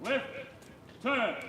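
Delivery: drawn-out drill call; drawn out parade commands. Real, not acted. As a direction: stretch the command across the square. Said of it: "marching recruits turn on command".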